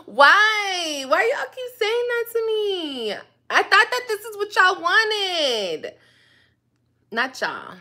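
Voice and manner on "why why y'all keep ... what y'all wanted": louder, higher, exasperated why; indignant outburst. Real, not acted. Answering viewers who can't stand her, loud exasperated protest. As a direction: protest it, fed up.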